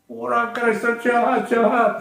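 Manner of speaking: high-pitched mock scream; retold shrill accusation; imitated angry yell. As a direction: cry it out high, without real volume. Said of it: "langsung dia teriak keras-keras".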